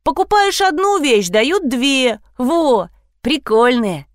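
high, eager justification; defensive. A narrator's reading. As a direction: explain it away like a child. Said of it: "начала отбиваться Лизавета". (оправдываясь, с напором)

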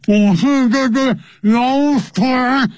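very high, slow, drawn-out silly voice; comic high-pitched drowning rower; mock underwater voice. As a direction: pretend to talk while rowing underwater, high and stretched. Mimics rowing while sunk underwater.

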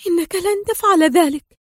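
high-pitched alarmed protest; panicked cry. A narrator's reading. Sonia, turning pale before Adham, panicked. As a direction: plead it out in dread. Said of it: "وهتفت في فزع".

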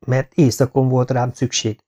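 ordinary delivery, nothing marked

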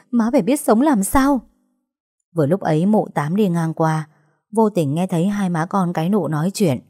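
ordinary delivery, nothing marked

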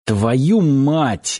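a gruff curse in another voice; understated angry oath; cursing. Acted. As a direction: spit out the swear word. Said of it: "выругавшись".

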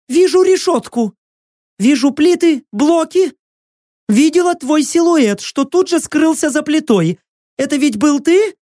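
high, urgent prisoner voice; tormented captive woman. A narrator's reading. Prisoner explaining what she sees, urgent high voice. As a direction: speak urgently in a high, strained voice.

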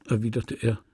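ordinary delivery, nothing marked

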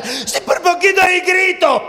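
high, loud, tight little voice; squeaky scared passenger. Acted. Passenger to pilot, high, loud, still shaken.

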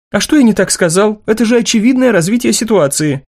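put-on young man voice. Martin's dialogue, voiced.

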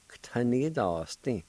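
"K, ta, ni, das, din" run together, K snapped straight into "ta"; quick K sound. Shown as a demonstration. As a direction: blend it into one word with a very fast vowelless K before "ta". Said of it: "had to say that K sound real fast".

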